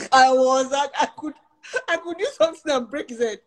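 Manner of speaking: high giggling retelling; half-laughing through the words; laughing while talking. Real, not acted. Telling the audience, laughing as she talks.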